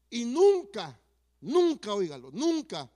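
loud, insistent "nunca" repeated; emphatic never. Real, not acted. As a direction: pound each "nunca" in indignation. Indignant about disrespectful suitor.

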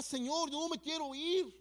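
high, slow, teary prayer; tearful plea. Retold or imitated. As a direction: relive the crying plea to God. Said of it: "yo empezaba a llorar".

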